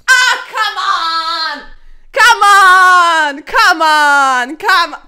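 drawn-out, very loud wail of frustration; exasperated yell. Real, not acted. Chess player losing the game, exasperated drawn-out yelling. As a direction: bellow it at the board, stretched out.

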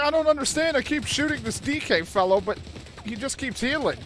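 high, loud puzzled voice; confused enemy voice. Retold or imitated. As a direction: play the baffled enemy soldier. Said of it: "He's like".

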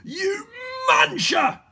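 high, loud villain's insult; angry shout. A narrator's reading. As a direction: hurl the insult at full volume. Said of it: "shouted Mister Gum".

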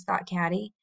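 usual speaking voice

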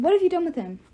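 anxious, accusing question; demanding. A narrator's reading. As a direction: press the villain for an answer. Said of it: "she demanded".

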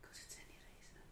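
fast hushed whisper. Real, not acted. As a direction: whisper the answer. Whispered answer.

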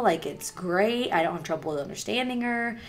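normal talking voice

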